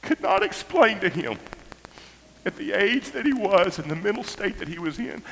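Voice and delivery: high voice breaking in short halting phrases; anguished, choked up. Real, not acted. Father confessing he had to hurt his child, anguished. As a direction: force each phrase out through tears.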